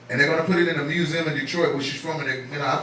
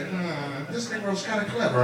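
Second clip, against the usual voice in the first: lightly voiced quiet musing; much softer, as if thinking it; imagined skeptical onlooker; 8 dB quieter than usual. Audience man silently judging the comic, musing. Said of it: "He's looking at me like".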